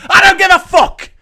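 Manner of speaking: high, very loud outburst; exploding in anger, pitched right up; furious shout. Real, not acted. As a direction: shout it back with full exasperation. Angry reply to mocked worrier.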